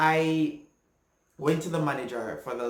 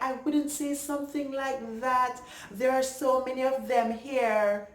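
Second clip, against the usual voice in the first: mock old lady; 10 semitones higher than usual. Mimicking the old lady whispering to the crowd, high and prim. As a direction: squeak it out like a prissy old lady. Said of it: "she was like".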